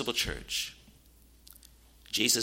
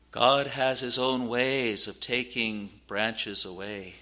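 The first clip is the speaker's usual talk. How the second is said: normal talking voice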